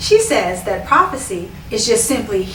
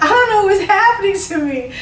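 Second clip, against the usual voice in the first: much higher, frightened retelling; scared; 9 semitones higher than usual. Retold or imitated. Woman overwhelmed by the dance, much higher frightened plea. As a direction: pitch it up like scared and lost. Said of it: "I was so scared".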